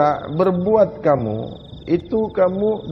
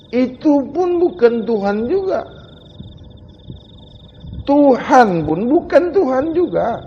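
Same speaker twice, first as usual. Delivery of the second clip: louder, rising sermon voice that keeps hammering each line; a fervent preacher pressing the crowd; fiery preaching; 3 semitones higher, 3 dB louder than usual. Acted. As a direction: preach it hard, one point after another. Preaching at full force.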